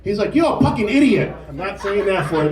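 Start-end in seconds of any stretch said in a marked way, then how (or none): 0.4-1.3 drill chief yell. Retold or imitated. Drill chief insulting recruit.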